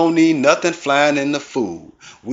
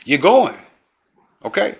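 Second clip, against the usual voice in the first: ordinary talk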